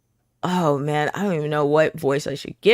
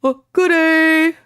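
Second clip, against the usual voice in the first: lightly voiced gentle giant, high and slow; a first try at a big sweet giant; high slow greeting as a gentle giant; 10 semitones higher, 5 dB louder, slower than usual. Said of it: "giant-y voice".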